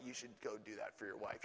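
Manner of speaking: low, soft, fast; a gentle inner nudge; voice of the Lord. Retold or imitated. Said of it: "the Lord's like".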